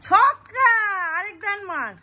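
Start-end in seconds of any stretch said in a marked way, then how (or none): 0.1-0.7 a sudden loud, high whoop at the roll; excited cheer. Real, not acted. Parent cheers a six at ludo. (উচ্ছ্বসিত জোরালো চিৎকার)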